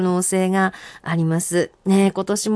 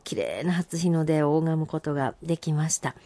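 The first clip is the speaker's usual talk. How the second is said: ordinary talk, nothing marked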